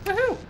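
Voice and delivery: high, loud whoop; celebratory woohoo. Real, not acted. Gamer celebrating a landing, high excited whoop. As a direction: whoop with glee.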